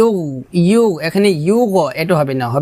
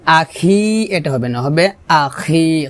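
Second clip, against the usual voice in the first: ordinary delivery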